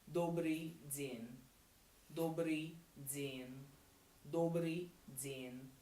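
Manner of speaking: добрый день drawn out very slowly; slow demo. Shown as a demonstration. Teacher repeating добрый день slowly for students. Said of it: "good afternoon is".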